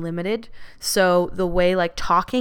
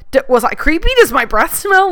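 higher, louder fretting self-doubt; mock insecure worry; 7 semitones higher, 5 dB louder than usual. Imagining a partner losing interest, mock panicky worry. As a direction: play her own anxious overthinking for laughs. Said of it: "I'll be like".

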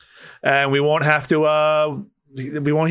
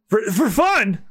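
higher, stammered disbelief; incredulous echo; 7 semitones higher, slower than usual. Acted. Victim echoing the swordsman's answer, incredulous. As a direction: repeat it in shocked disbelief.

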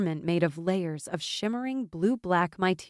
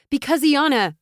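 loud, high outburst cut short; heated reply abandoned midway; breaking off; 8 semitones higher, 8 dB louder than usual. A narrator's reading. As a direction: start to blurt the reason, then stop. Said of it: "Brielle broke off".

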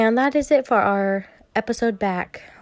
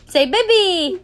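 high, loud prompt to a little one; 8 semitones higher, 5 dB louder, much slower than usual. Real, not acted. Parent teaching a toddler first words, coaxing.

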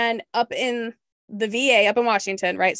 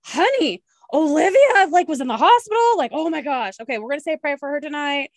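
worried excitement; 5 semitones higher, 5 dB louder than usual. Retold or imitated. Worried about friend in hospital.

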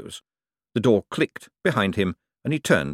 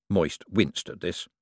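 plain reading voice

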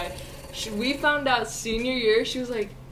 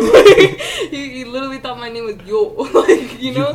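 laughing; 4 semitones higher, 13 dB louder than usual. Real, not acted. Laughing at the Yo story.

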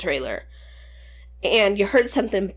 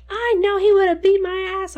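mom voice; 11 semitones higher, 4 dB louder than usual. Retold or imitated. Mom retelling her fear.

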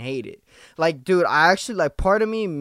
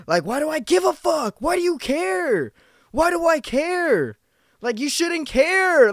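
much higher, slowing, exasperated questions; exasperated self-talk; 12 semitones higher, 4 dB louder than usual. Real, not acted. Questioning himself about caring what coworkers say, exasperated and high. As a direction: scold yourself for caring.

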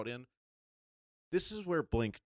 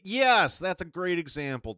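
loud, high burst of agreement; emphatic, raised-voice yes; excited agreement; 7 semitones higher, 9 dB louder, slower than usual. Real, not acted. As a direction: jump in, delighted by the perfect example. Emphatic agreement with the co-host's example.

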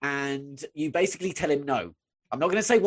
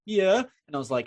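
gentle coaxing; 9 semitones lower, 3 dB quieter than usual. Retold or imitated. Comforting a bawling fifteen-year-old, gently coaxing. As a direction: drop low and soft to coax.

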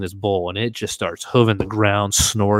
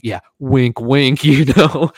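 knowing wink; 5 semitones higher, 8 dB louder, slower than usual. Retold or imitated. Mock knowing wink to stranger.

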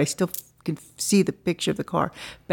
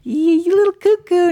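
high, loud teasing voice; mocking friends; 13 semitones higher, 7 dB louder than usual. Friends teasing the twins about telepathy, high mocking call. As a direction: tease them as a nut. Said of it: "and they're like".

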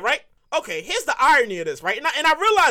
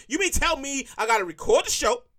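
normal talking voice

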